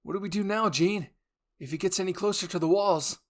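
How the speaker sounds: high and loud, frightened; desperate plea for orders; a frightened soldier asking Jean, high and loud; panicked question. Acted.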